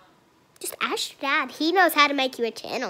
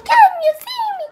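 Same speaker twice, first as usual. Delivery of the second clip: much higher, louder, fast squeal; excited squeal; 8 dB louder, faster than usual. Pretend. Girl asking viewers again, excited squeal. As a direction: squeal it at the camera.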